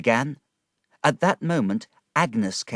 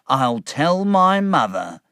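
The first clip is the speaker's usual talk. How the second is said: slow gloating Uriah; 6 semitones higher, 6 dB louder, slower than usual. A narrator's reading.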